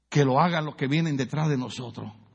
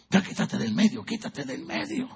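mock impatient shove-off, trailing into a higher, much softer mutter; impatient Puerto Rican; 7 semitones higher, 3 dB quieter than usual. Pastor mimicking an impatient Puerto Rican, trailing off. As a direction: hurry them out of the way, fading. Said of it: "los puertorriqueños somos nerviosos y desesperados".

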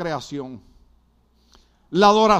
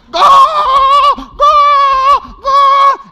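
goles alargados, muy agudos y fuertes; gritos de gol; 15 dB louder, much slower than usual. Acted. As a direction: imitar al hincha eufórico a todo pulmón. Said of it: "Perdonen los gritos". (gritar gol como fanático)

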